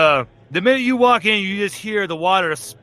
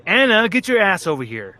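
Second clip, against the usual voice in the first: calling out; 5 semitones higher, 4 dB louder than usual. Acted. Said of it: "Pamela just looks back and goes".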